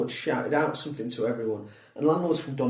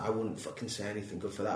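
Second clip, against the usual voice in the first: retold thought, low and much softer; muttered self-criticism; 5 semitones lower, 11 dB quieter than usual. Comic recalls watching own set, muttered self-criticism. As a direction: drop it to a quiet, low aside to yourself.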